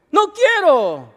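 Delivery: high, loud, slow whiny refusal; boy refusing his teacher, whining; child's refusal. Acted. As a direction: dig in like a stubborn kid.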